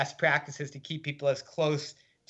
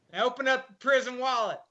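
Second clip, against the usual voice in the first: much higher, gruff Kentucky drawl order; Kentucky drawl guard; 10 semitones higher than usual. Retold or imitated. Gruff guard ordering the strip search in Kentucky drawl. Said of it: "spoke gruffly in a thick Kentucky drawl".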